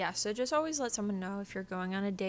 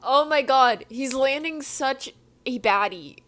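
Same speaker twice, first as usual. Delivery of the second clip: loud, high exclamation; exclaiming outburst; 5 semitones higher, 8 dB louder than usual. Real, not acted. Reader reacting to Edward, loud exclaiming outburst. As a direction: burst out in disbelief at the book. Bursting out over the book.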